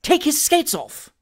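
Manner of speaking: high, slow, stern command. A narrator's reading. Indignant gentleman to his servant, stern order. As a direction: order it indignantly, drawing out the words. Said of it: "said in a stern voice".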